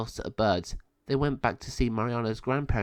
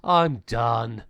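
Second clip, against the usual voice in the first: high, louder sulking witch voice; grudging surrender; 8 semitones higher, 5 dB louder, much slower than usual. Acted. Beaten villain concedes to the heroes, sullen surrender. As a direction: give in with bitter frustration. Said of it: "growling in anger and frustration".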